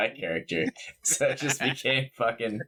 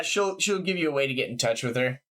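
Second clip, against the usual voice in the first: normal talking voice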